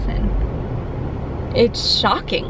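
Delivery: shocked. Real, not acted.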